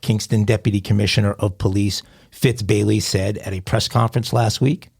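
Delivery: ordinary delivery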